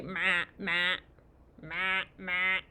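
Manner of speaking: imitated goat bleats in short repeats. Reader shows a child what goats say, imitated goat bleat. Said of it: "They say".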